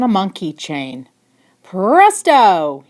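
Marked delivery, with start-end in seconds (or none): 1.6-2.8 shout. Acted. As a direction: call out the magic word loudly. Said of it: "he shouted".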